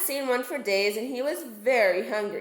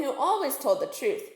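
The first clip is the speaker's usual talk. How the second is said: usual speaking voice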